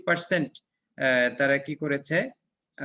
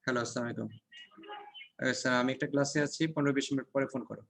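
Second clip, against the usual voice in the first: hushed, soft phone reply; whispering on the phone; 2 semitones lower, 6 dB quieter, faster than usual. Real, not acted. Takes a phone call during class.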